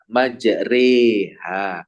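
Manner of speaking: very slow Majreha with ro tilted to re; imalah on Majreha. Shown as a demonstration. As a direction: lean the ro into e in Majreha. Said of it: "miring gitu ya".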